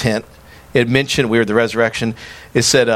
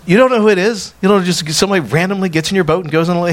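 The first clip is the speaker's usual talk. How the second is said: higher-pitched imitation of a baffled friend; incredulous; 6 semitones higher, faster than usual. Friend questioning him about a stranger in the boat, incredulous. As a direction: retell it as a mildly disbelieving question, quickening.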